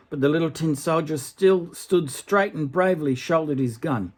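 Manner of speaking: gentle storybook reading voice; story narration. A narrator's reading. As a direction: read it like a bedtime tale. Reads a fairy tale aloud.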